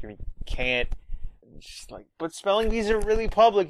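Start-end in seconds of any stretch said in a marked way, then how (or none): 2.2-3.7 put-on high, quick girl's voice, lightly done; mock Lisa. Lisa warning Bart, in a high quick mock voice. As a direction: rush it up high, warning him.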